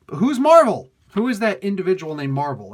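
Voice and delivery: exasperated disbelief. Real, not acted. Reader still baffled by the credit, exasperated disbelief. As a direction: burst out with the question, pitch leaping.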